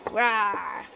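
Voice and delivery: low playful rawr; creature roar. Acted. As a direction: growl like a sea monster. Sea serpent toy roars.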